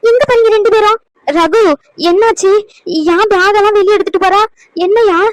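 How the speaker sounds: a startled granny calling her grandson; alarmed grandmother. Acted. Grandmother to grandson about the bags, alarmed and loud.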